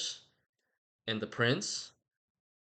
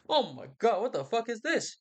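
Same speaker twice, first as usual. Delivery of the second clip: mock shock; 9 semitones higher than usual. They find Romeo dead.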